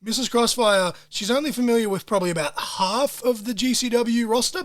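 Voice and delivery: usual speaking voice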